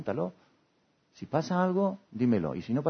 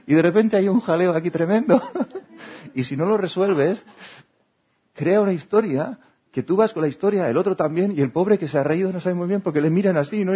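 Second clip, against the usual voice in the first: higher and louder, building comic chaos; excited telling; 8 semitones higher, 8 dB louder than usual. Real, not acted. Describing a misunderstanding blowing up, higher and louder. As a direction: let the mess tumble out with glee.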